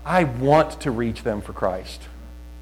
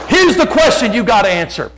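high, loud public proclamation, re-enacted; loud declaring; 8 semitones higher, 6 dB louder than usual. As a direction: declare it to the whole store. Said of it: "You don't have to be loud like me".

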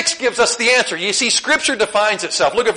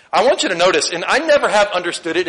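ordinary talk

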